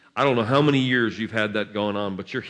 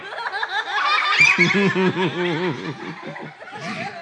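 long laugh; 8 semitones higher than usual. Real, not acted. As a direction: let the laugh run on. Long laugh after shout.

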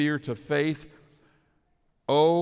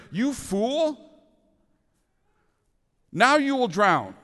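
much higher, louder scolding, hammed-up; mock harsh rebuke; 9 semitones higher, 5 dB louder, slower than usual. Jesus as he never spoke to Peter, mock harsh rebuke. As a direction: bark it like a scornful master. What Jesus did not say.

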